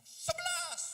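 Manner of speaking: very high, loud shout; blurted answer. Retold or imitated. Eko answering father at last, high blurted shout. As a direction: burst out with it after a long stall.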